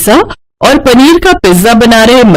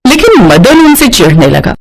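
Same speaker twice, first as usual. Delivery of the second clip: ordinary delivery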